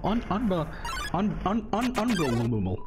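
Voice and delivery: high, stuttering can't-believe-it; stammered incredulous unbelievable; stammering disbelief. Real, not acted. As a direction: trip over unbelievable in shock. Stunned stammer after losing.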